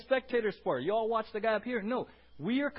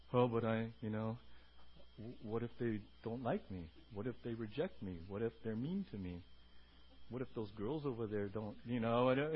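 lower, softer, worried inner voice; mock anxious believer; 10 semitones lower, 9 dB quieter than usual. Pastor voicing a timid believer's worries, softly anxious. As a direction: mumble the doubts nervously.

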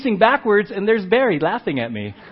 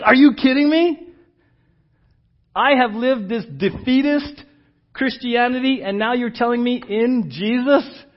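high-pitched, incredulous reaction; acted disbelief; 4 semitones higher than usual. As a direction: react like a believer stunned by the news. Early believer's astonished reaction acted.

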